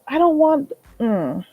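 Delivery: ordinary delivery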